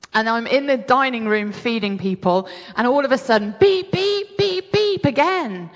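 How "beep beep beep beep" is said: high-pitched electronic beeping; imitated alarm beep. Storyteller mimicking the oven alarm at dinner, high imitated beeps. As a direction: pitch it right up like an insistent alarm.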